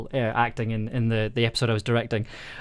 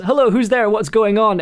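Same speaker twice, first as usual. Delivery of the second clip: higher-pitched questioning call; acted character's higher, questioning voice; game character calling out; 7 semitones higher, 4 dB louder than usual. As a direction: call out to whoever might be there. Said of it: "your character says".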